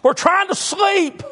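high, loud yell of a grumpy prisoner; angry prisoner. Retold or imitated. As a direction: bark it at the singers next door. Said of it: "prisoners two cells down saying".

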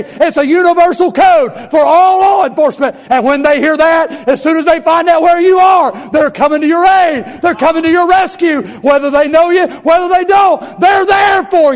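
loud, rising sermon build; preaching at full force. Acted. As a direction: preach it to the rafters.

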